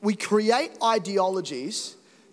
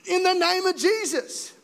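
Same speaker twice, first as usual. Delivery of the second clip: bold prayer; 9 semitones higher than usual. Pretend. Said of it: "we need to be like".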